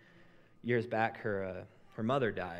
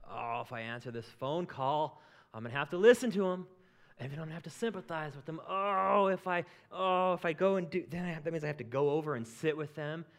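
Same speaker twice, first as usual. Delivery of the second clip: whiny excuse-maker; 5 semitones higher, 5 dB louder than usual. Retold or imitated.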